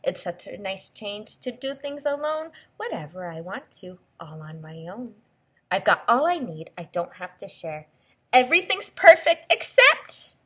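lilting storybook read-aloud; expressive picture-book voice; reading to children. Acted. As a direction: read the book's lines to a little listener.

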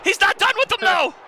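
high shouted kick call; fired-up wrestling commentator; excited play-by-play. Real, not acted. Calling a move live.

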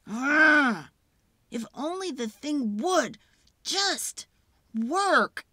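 loud, fed-up groan then a snapped demand; the girl boiling over at her broken project; frustrated outburst. Acted. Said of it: "her brain is too full of the not right things".

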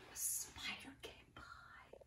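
slow, hushed whisper; spooky whisper. Acted. Storyteller whispering the spider's arrival to children. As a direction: hush them with a creepy secret.

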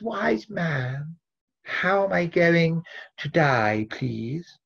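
a hero faking a lady's voice to fool the wise man; man disguised as a woman. Acted. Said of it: "this time he dresses in a dress".

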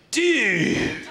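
slow amazed surfer moan; stoned surfer. Retold or imitated. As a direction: play the spaced-out beach guy at the end of his thought. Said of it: "And he's like".

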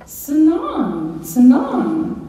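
high, loud parrot squawk of seven; high squawked parrot word. Retold or imitated.

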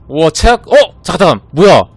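high, loud disbelieving yelps; shocked outcry. Real, not acted. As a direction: react to a disaster. Bad result revealed.

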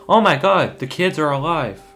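loud, high gasp of amazement; amazed. Real, not acted. Player reacting to the kids being alive, amazed. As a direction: burst out in real astonishment.

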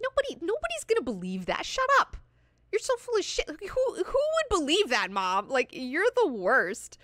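high-pitched bratty protest; mock teenage outrage, way up high; incredulous scorn. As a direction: squeal in disbelief at a parent. Acts son scornfully contradicting his mother.

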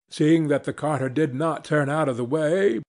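ordinary delivery, nothing marked

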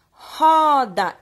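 "Roda" with a long, loud open O, like "ó"; changed O sound. Shown as a demonstration. Teacher shows pupils the changed vowel, open O. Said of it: "esse O também mudou o som".

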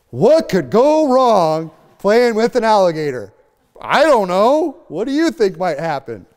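very high, slow, hammed-up cluelessness; exaggerated sing-song sarcasm; mock naive. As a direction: stretch it out in a silly innocent put-on voice. Hammed-up naive person playing with danger.

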